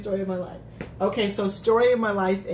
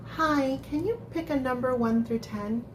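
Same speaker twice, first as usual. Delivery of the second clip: put-on sweet baby doll talk, pitched up; 5 semitones higher than usual. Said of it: "let me do the Femme Queen, um, baby doll voice".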